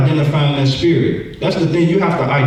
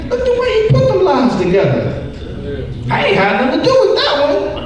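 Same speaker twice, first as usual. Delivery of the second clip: much higher, laughing proud demon voice; gleeful demon; 14 semitones higher than usual. Acted. Demon praising the liar it lives in, high and laughing. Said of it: "that demon is kicking back like".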